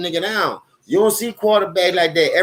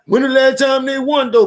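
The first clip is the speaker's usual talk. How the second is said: mocking rival fans; 9 semitones higher, 4 dB louder than usual. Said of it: "that's like y'all saying".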